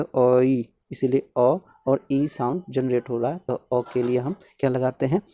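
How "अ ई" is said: अ stretched slowly into ई; slow motion. Shown as a demonstration. Teacher breaking ऐ into its sounds, in slow motion. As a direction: glide unhurriedly from अ to ई. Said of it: "slow motion में बोला जाए तो".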